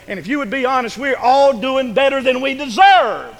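loud, high, impassioned sermon climax; ringing pulpit crescendo; full-force preaching. Real, not acted. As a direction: drive it home to the whole congregation.

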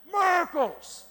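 high, loud holler of discovery. Retold or imitated. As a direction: yell the find across the field. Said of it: "he was hollering something".

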